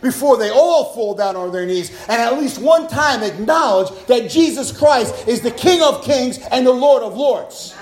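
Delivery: loud, ringing proclamation; full-force preaching. Real, not acted. Preacher declaring Jesus King of Kings, full-force preaching. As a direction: preach it out with punched key words.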